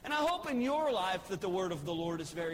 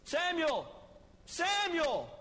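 higher, calling out from afar; voice of the Lord calling; 6 semitones higher, slower than usual. Acted. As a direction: call the boy's name like a distant voice. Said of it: "he hears the same voice".

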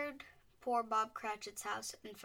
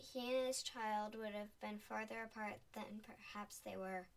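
usual speaking voice

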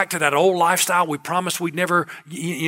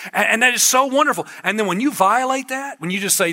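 normal talking voice

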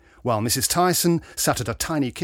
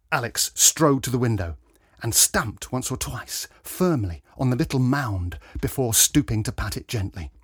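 plain reading voice

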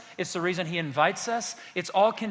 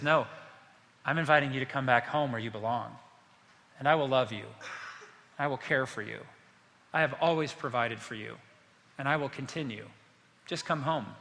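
softer, low reassurance with pauses; quiet, lowered voice, letting each promise settle; tender invitation; 4 semitones lower, 4 dB quieter than usual. Acted. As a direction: gently coax a loved one home. God's tender invitation instead of crushing.

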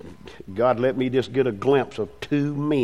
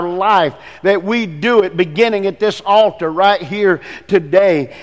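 fervent, raised praying voice over the congregation; pastoral prayer; 5 semitones higher, 4 dB louder than usual. Acted. Pastor praying over mothers, men and children, fervent prayer. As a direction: pray aloud for the church, rising with feeling.

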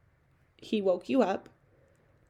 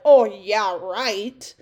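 sarcastic; 8 dB louder, much slower than usual. Retold or imitated. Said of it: "that sounds maybe to some of you like".

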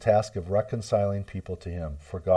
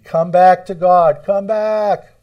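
high, loud, drawn-out plea; pleading call; 11 semitones higher, 14 dB louder, slower than usual. Acted. Preacher pleading with listeners to return to God, high loud plea. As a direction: cry out, begging them to come home. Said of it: "We speak for Christ when we plead".

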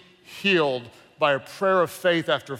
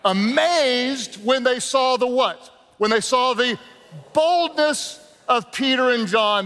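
emphatic preaching build; 6 semitones higher than usual. Acted. Preacher building up, crowd call.

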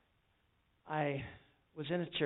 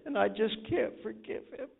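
higher, pleading voice of the wife; lightly voiced upset wife; a hint of a distraught wife; wife who can't forgive; 8 semitones higher, 3 dB louder than usual. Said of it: "And the wife said".

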